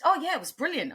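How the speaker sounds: high-pitched, quoting someone's polite praise; mimicked audience member. Mimics audience member after a show.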